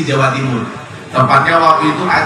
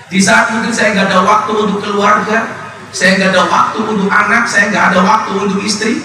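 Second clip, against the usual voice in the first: rising, higher-pitched confession to the crowd; sermon voice lifting higher on each line; impassioned preaching; 5 semitones higher than usual. Acted. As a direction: preach it, voice climbing with regret. Preaching builds up.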